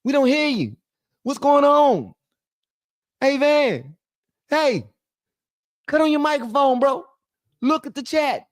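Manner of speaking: very high, loud mock heckling; high-pitched pestering voice; complaining chat viewers. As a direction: squeal at the streamer as an annoyed audience. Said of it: "y'all like".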